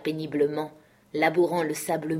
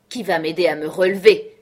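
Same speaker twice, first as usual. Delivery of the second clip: louder furious shout for help; 10 dB louder than usual. A narrator's reading. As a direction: bellow it at the men who won't move. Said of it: "hurla-t-il".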